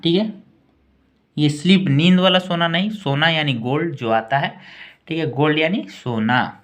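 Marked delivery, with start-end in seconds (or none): none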